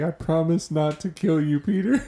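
high, pleading imitation of sad Ned; mock sad. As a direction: promise it in a high, hammed-up sad voice, half laughing. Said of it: "Ned gets so sad, dude".

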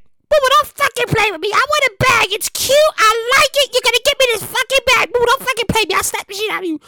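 high, demanding mother voice; mock mom. Son mimicking his mom demanding a handbag. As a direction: pitch up into a pushy mom.

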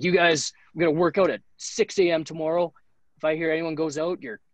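mock furious coach warning; angry coach. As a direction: threaten the team like an irate coach. Angry coach threatening the team.

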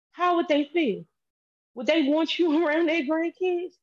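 high, agitated retelling; heated. Real, not acted. Heated questioning, high pitch.

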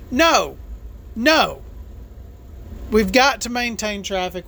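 ordinary delivery

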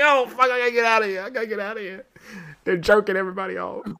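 high, hurried comic alarm; hammed-up high-pitched panic; mock panic. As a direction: play it as a frantic urge to flee. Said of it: "I'm like".

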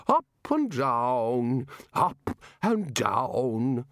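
very slow, dreamy repetition; addled bursar. Acted. Muddled bursar lost in his boat, slow and vague. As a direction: drag it out like a bobbing boat.

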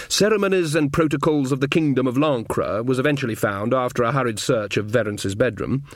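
ordinary delivery, nothing marked

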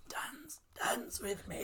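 breathy, hushed raspy whisper. Acted.

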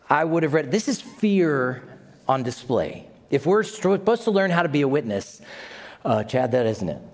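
usual speaking voice

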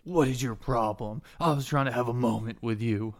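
indignant wounded man; wounded indignation. Acted. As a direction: protest loudly while clutching a wound. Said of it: "He holds his gut and he's like".

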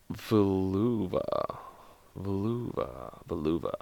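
very soft, low practice; sounding it out. Real, not acted. Working out a word before reading the joke, murmured. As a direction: murmur the word carefully to yourself. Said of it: "trying to figure out what the word is".

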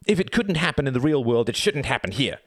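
put-on high, heated boyish protest; shouted argument. A narrator's reading. Reg disputes the rules with his troops, angry shout. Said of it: "shouted Reg".

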